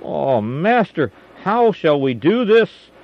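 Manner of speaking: high, loud, slow cry of panic; frightened plea. A narrator's reading. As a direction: plead in terror at the surrounding army. Servant panics at enemy host.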